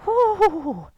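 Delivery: high, loud whoo on the out-breath; whooping exhale. Real, not acted. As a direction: blow it out with a cheer. Exhale after last breath.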